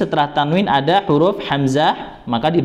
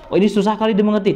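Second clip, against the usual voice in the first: imagined student complaint; 7 semitones higher, much faster than usual. Retold or imitated.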